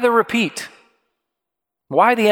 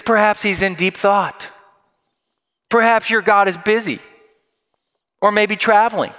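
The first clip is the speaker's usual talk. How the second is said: mock-helpful taunt, pitched higher; 5 semitones higher than usual. Elijah to the prophets of Baal, lightly taunting. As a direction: lightly needle them with pretend suggestions. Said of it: "Elijah is taunting these prophets".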